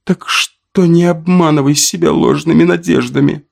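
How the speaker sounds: high, sharp scolding; stern self-reproach. A narrator's reading. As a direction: snap at yourself. Woman scolds herself inwardly.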